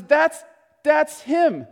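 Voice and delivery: acted burst of astonished recognition; excited recognition. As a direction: blurt it in dawning realisation. John excitedly recognises Jesus.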